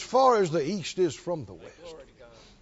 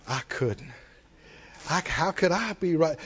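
low, soft, feigned humility; mock unworthiness; 4 semitones lower, 6 dB quieter than usual. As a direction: shrink from the gift in mock self-pity. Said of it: "you don't go".

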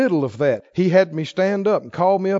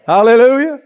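louder, exultant hallelujah; joyful hallelujah; 6 semitones higher, 11 dB louder than usual. Real, not acted. Preacher praising God that things got better, loud and exultant.

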